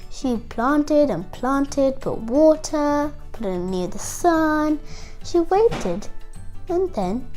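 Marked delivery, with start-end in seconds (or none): none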